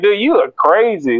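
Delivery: high-pitched comic disbelief; mock-astonished reply; incredulous onlooker. Onlookers answer Saul in acted exchange.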